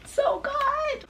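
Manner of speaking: high, drawn-out squeal of delight; gushing praise. Real, not acted. Gushing over a favourite film, high delighted squeal. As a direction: sing it out high, thrilled.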